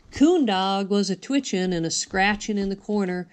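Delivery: ordinary delivery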